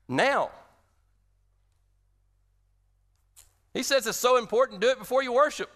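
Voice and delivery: preaching at full force. Acted. Pastor urging his congregation to reconcile, preaching hard. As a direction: drive home the word now like a revival preacher.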